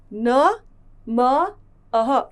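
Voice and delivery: न, म, अह said apart with pauses; split into sounds. Shown as a demonstration. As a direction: break नमः into its sounds. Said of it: "म के साथ अह विसर्ग की मात्रा बोलते हैं मह". (न ... म ... अह, रुक-रुककर)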